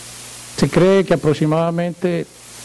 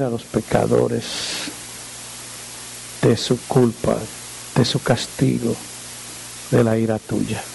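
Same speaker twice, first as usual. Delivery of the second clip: lower, soft, unhurried prayer voice; 4 semitones lower, 4 dB quieter than usual. Acted. Preacher praying aloud for the congregation, hushed. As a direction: pray quietly and humbly before God.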